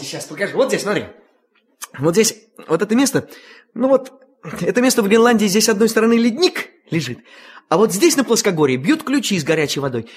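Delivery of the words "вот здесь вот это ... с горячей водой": usual speaking voice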